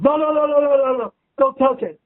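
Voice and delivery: a producer urgently begging him to keep the take; excited insisting producer. Retold or imitated.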